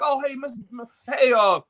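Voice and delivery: high, glad-handing mayor voice greeting voters by name; mock politician greeting. Imitating a mayor greeting families by name, high and chummy. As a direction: play a schmoozing mayor working the room. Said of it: "they be like".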